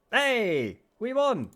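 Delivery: high, loud burst of joy; excited cheer. Real, not acted. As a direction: cheer the win. Wins the tournament in game.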